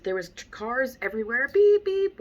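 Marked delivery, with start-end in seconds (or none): 1.5-2.2 high, loud mock horn beeping; a honking car imitated in a story; car horn beeps.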